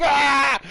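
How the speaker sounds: loud, high re-enacted scream; freak-out scream. Dad retelling the spider jumping at him, mock panicked scream. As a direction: scream as if startled, hammed up. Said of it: "So I freak out".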